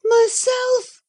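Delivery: sharp, very high shocked gasp of a word. A narrator's reading. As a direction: caught by horror at her own reflection. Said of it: "she gasped".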